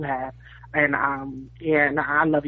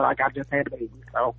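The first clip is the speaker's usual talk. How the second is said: ordinary talk, nothing marked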